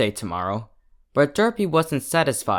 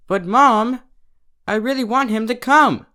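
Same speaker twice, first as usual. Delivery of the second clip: put-on high, pleading child voice; begging filly; 11 semitones higher, 5 dB louder than usual. Little Derpy pleading with her mother, high and begging. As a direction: beg like a little girl on her knees. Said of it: "Derpy Hoops begged".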